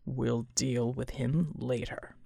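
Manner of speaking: low, slow, stern and quiet. A narrator's reading. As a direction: cut him off with deep, measured control. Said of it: "Bulwark said sternly".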